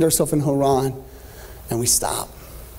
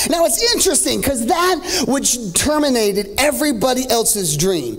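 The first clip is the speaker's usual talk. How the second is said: preaching at full force; 10 semitones higher than usual. Real, not acted. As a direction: whoop it up to the crowd, building. Preacher builds to sung climax.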